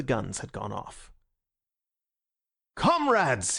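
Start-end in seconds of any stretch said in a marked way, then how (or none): 2.8-3.6 ringing cry. Acted. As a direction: let it ring like a gun going off. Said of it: "he cried in a voice that made every man jump".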